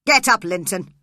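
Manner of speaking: sharp loud command; angry shout. Acted. Heathcliff shouts angrily at his son Linton. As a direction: bark it at a lazy son. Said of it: "He shouted angrily to his son".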